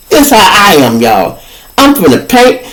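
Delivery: ordinary delivery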